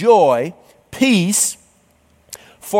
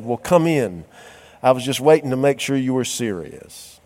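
put-on lower, softer old man welcome; gentle old man; 7 semitones lower, 3 dB quieter than usual. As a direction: relent softly and kindly. Wise man finally relents.

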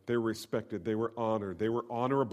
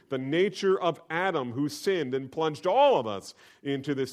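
fiery preaching; 8 semitones higher, 7 dB louder than usual. Acted. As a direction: drive it home to the congregation. Preacher at full force.

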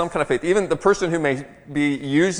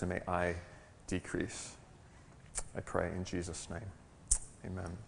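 prayer; 7 semitones lower, 10 dB quieter than usual. Real, not acted. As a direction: pray quietly and unhurriedly, pausing between phrases. Leads the congregation in prayer.

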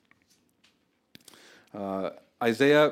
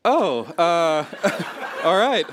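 laughing through a startled oh, all right, higher and louder; flustered laughing lady; 7 semitones higher, 8 dB louder, much slower than usual. Retold or imitated. Cashier taken aback by the child, laughing and flustered. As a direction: play the cashier caught off guard, laughing. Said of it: "the lady goes".

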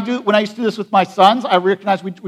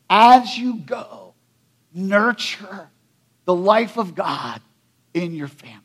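slow tender exhortation; 2 semitones lower than usual. Acted. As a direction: linger on each phrase like a benediction.

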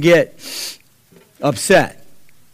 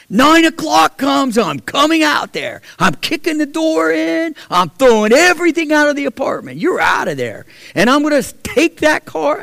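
retold furious threats; 10 semitones higher than usual. Landlord threatening the tenants over the phone, angry shout. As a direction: lay down the threats, building fury.

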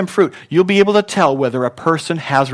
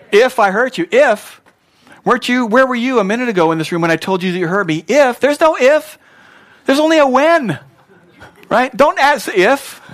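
high, loud mock outrage; indignant retort; 10 semitones higher, 6 dB louder than usual. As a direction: throw the words back, incredulous and rising. Acted indignant reply to bad apology.

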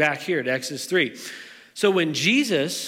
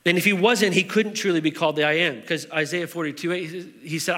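usual speaking voice

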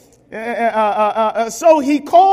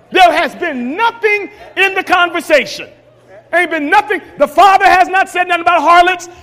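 higher, shouted sermon climax; preaching at full force; 5 semitones higher than usual. Acted.

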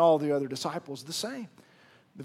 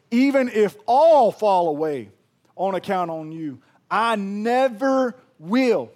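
declaring; 4 semitones higher, 11 dB louder, slower than usual. Retold or imitated. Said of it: "Peter replies this".

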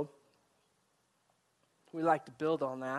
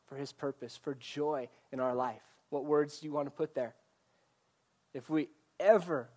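usual speaking voice

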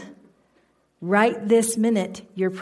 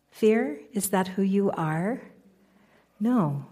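normal talking voice

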